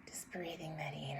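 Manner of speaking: a gentle whisper inviting a breath; breathy whisper. Acted. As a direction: hush it like a meditation guide.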